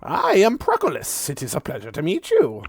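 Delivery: put-on high, loud, cackling old skeleton; booming high-pitched skeleton voice with a cackle; skeletal archdruid. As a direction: play a jolly undead druid, way up high.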